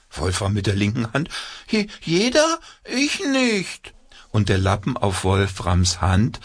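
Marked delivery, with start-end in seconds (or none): 1.7-3.9 very high stammering puppet voice; dismayed puppet. A narrator's reading.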